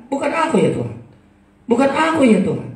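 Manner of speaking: high, loud pleading denial; protesting disciple. Acted. Disciple protesting to the Lord, loud anxious denial. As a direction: cry out your innocence. Disciples deny betrayal to Jesus.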